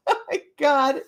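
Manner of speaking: ordinary talk, nothing marked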